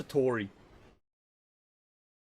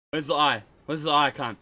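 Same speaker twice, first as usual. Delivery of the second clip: normal talking voice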